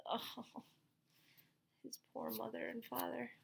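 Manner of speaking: usual speaking voice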